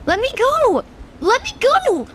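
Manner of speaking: high, frantic pleading; panicked cry. Acted. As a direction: cry out to be freed. Kid grabbed by bully.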